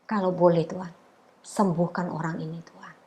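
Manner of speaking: soft, near-whispered plea to God; quiet whispered prayer; hushed prayer. Retold or imitated. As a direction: pray it under your breath, tearfully tender. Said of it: "aku cuma doa gini".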